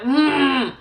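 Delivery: loud retold pained moan; a hammed-up groan of pain, mouth closed; weird moan. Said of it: "doing these weird moans".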